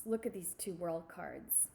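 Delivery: ordinary delivery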